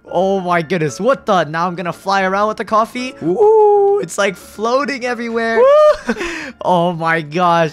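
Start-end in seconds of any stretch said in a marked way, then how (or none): 3.2-4.0 high, loud, drawn-out "woo"; playful ghostly woo. Pretend. Ghost flying coffee around to spook a worker, playful woo. 5.6-6.1 very high, loud whoop; playful ghostly woo. Pretend. Ghost flying coffee around to spook a worker, playful woo.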